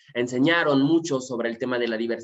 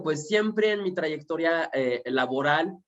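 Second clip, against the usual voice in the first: usual speaking voice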